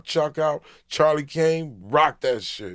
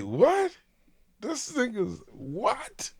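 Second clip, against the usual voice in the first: much higher, slow, drawn-out disbelief; stunned what in a mock shocked voice; 12 semitones higher, slower than usual. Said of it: "And we was shocked".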